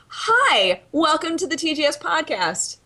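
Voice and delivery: host greeting. Acted. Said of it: "So I'm just gonna say".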